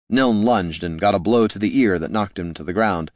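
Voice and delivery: ordinary delivery, nothing marked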